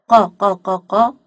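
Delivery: "ق" repeated loudly as short qa beats; loud, staccato "ق" said as qa; qaf with fatha. Shown as a demonstration. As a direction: drill "ق" as punched, separate qa syllables. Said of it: "قاف فتحة".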